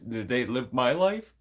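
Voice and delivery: usual speaking voice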